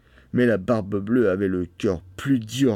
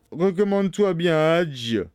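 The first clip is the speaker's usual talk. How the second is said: menacing Bluebeard; 7 semitones higher than usual. A narrator's reading. Bluebeard about to strike his wife, menacing. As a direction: intone it as a last warning.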